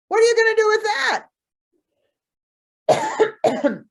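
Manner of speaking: high, loud frightened squeal; man terrified of the obsidian knife; panicked. Acted.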